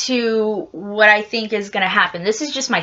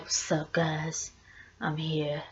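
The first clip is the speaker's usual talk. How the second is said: low, much softer, deadpan Ragnar; bad Ragnar impression; 7 semitones lower, 14 dB quieter than usual. Ragnar shows up casually greeting everyone, low and soft. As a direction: murmur it low and casual like he never left. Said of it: "was a horrible impression of him".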